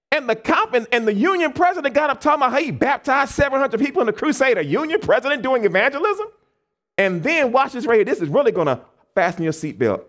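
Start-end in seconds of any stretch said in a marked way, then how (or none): none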